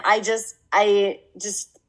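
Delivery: ordinary delivery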